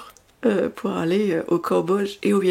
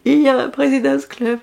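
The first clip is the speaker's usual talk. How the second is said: much higher, jubilant cry; husband's excited news; 5 semitones higher than usual. Retold or imitated. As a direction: burst out like the husband with the good news. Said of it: "mon mari m'a dit".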